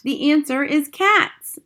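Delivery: loud, high, slow announcement; triumphant shout. A narrator's reading. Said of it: "I shouted".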